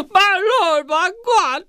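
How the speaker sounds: gasp, then a very high-pitched shocked exclamation; hammed-up shock, squealing far above normal pitch; mock freak-out. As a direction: blurt it like a startled overreaction. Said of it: "He's kind of freaking out".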